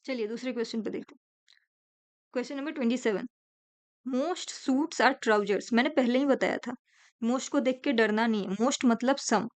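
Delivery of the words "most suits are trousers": each word drawn out slowly; slow teacher dictation of a statement. Shown as a demonstration. Teacher reads statement aloud.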